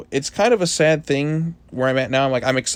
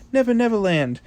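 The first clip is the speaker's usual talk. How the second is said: playful high repeat; 10 semitones higher than usual. Shown as a demonstration. Repeating the place name playfully.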